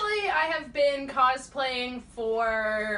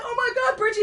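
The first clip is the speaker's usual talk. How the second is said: mock excited fans; 12 semitones higher, 6 dB louder, faster than usual. Fans reacting to Bridget cosplay.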